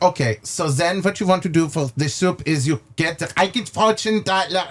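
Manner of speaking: lightly put-on German accent, chef rattling on. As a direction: imitate the chef's German accent while he explains. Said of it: "How do you like his, uh, little, uh, accent?".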